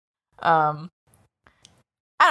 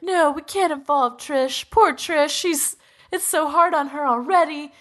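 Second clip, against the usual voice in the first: higher-pitched, pleading for someone else; mock pleading protest; 6 semitones higher than usual. Acting out a character protecting Trish, pleading higher.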